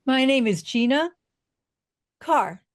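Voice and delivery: GINA lifted, pause, short falling CARR; pause and punch. Shown as a demonstration. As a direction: pause before CARR, first attempt at a punch. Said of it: "with a pause and a punch".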